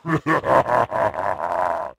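deep gloating brute laugh; evil chuckle. Acted. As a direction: laugh like a beast over its kill. Brute gloats over trophy.

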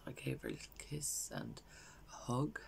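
grief-stricken, hushed, long breathy pauses; Valerie. Acted. Bereaved mother recalling saying goodbye to her daughter, hushed grief. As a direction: let it sink softly to a near whisper. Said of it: "this is a character called Valerie".

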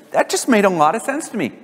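usual speaking voice

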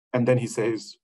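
usual speaking voice

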